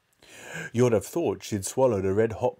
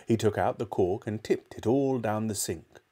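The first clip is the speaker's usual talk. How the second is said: ordinary delivery, nothing marked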